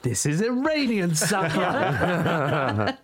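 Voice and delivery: high, drawn-out mock indignation breaking into laughter; mock outrage. Diner complaining at a restaurant, in laughing mock outrage. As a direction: play the scandalised diner, slowly and hammed-up.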